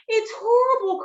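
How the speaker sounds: high, loud wail of dismay; sheep's anguished cry. Acted. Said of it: "cried Lola".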